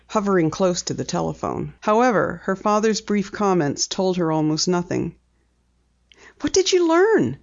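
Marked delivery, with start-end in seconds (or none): 6.4-7.4 higher, eager asking; eagerly pressing for news; eager question. A narrator's reading. Penny asking her father after his call, eager. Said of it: "she inquired eagerly".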